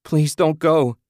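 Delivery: loud, high, begging cry; husband begging his wife not to leave; pleading cry. A narrator's reading. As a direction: beg her to stay at full voice. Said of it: "Ted cried".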